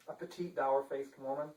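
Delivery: ordinary delivery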